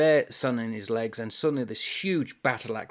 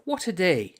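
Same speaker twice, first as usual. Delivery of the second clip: mild angry; 8 semitones higher, 4 dB louder than usual. Acted. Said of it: "he repeated angrily".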